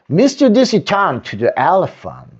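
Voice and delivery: plain reading voice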